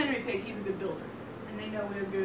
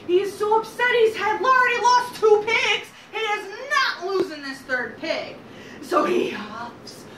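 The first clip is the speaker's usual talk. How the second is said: high, loud, emphatic storytelling; worked-up narration; 9 semitones higher, 6 dB louder, slower than usual. A narrator's reading. Storyteller telling of the frustrated wolf, worked up. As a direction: raise pitch and volume with the wolf's frustration.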